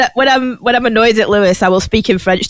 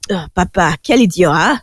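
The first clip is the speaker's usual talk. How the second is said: French; 5 semitones lower than usual. Retold or imitated. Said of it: "I'm just like".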